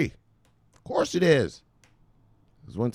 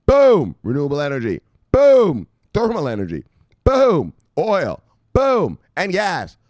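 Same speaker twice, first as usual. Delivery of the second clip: loud, higher booms between items; hyped booms; 6 semitones higher, 8 dB louder than usual. Real, not acted. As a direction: boom out each item. Excitedly rereading expansion list.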